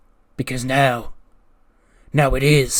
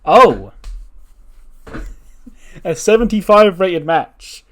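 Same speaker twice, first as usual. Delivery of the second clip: higher, louder and slowed with delight; excited; 5 semitones higher, 8 dB louder, slower than usual. Real, not acted. Reading the match rating out to viewers, thrilled. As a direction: savour the rating like a win.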